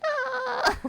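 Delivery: startled mock scream at a cockroach; mock shriek. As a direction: shriek like a cockroach is in front of you. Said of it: "I'd be like".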